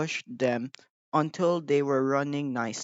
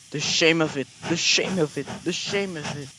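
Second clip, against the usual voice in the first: grumbling; 3 dB louder than usual. A narrator's reading. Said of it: "he grumbled".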